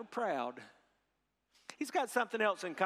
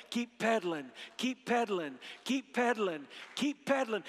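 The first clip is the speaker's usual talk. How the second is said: building chant, rising in pitch; a little louder; 4 semitones higher than usual. Shown as a demonstration. Preacher gets audience chanting along with him, a little louder. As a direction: lead the crowd in a growing rhythmic chant. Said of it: "A little louder".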